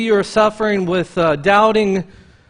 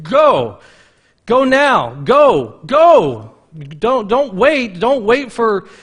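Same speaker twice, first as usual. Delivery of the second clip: louder, higher, pressing preacher's call; urgent preaching; 5 semitones higher, 5 dB louder than usual. Acted. Pastor urging listeners to leave sin now, full-force preaching. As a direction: urge them to go now.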